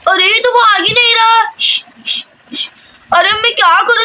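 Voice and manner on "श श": breathy shush to drive off a bird; unvoiced shoo; shooing hiss. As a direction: hiss the parrot away. Shooing parrot.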